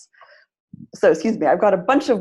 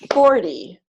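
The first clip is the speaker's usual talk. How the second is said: "forty" with FOR punched and long; stress on first syllable; 4 dB louder than usual. Shown as a demonstration. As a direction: clap out FOR-ty for "forty". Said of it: "is the first syllable".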